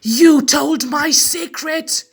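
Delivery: loud, slow furious roar. Acted. The furious king accusing Meg, loud slow roar. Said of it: "he roared at Meg".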